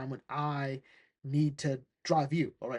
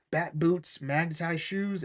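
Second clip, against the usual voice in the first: ordinary talk, nothing marked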